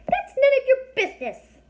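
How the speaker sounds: very high, tiny, squeaky fairy voice; a little fairy snapping at strangers, high and squeaky. A narrator's reading. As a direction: speak like a fairy only inches tall. Fairy voice held.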